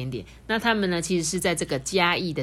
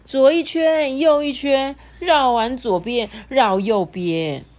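dramatic loops; 7 semitones higher, 7 dB louder, slower than usual. A narrator's reading.

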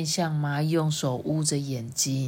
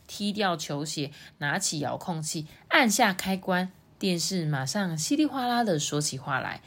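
plain reading voice

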